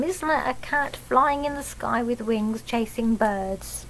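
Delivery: usual speaking voice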